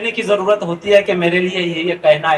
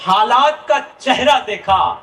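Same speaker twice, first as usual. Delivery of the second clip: stately nazm recitation; 5 semitones lower than usual. Acted. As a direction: recite the poem with sorrowful weight. Nazm Sulagta Manzar recited.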